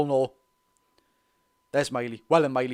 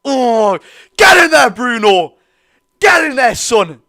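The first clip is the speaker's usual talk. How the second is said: high, loud shouts of get in there; imitated celebration yell; 6 semitones higher, 9 dB louder, slower than usual. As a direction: ham up his wild cheering for Bruno. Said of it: "but he's went".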